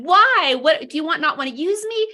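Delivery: high, loud cry of why at heaven; anguished outburst. Retold or imitated. Speaker replaying her embarrassing outburst at God in the car, high anguished cry. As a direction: throw the question up in despair, half-shouting.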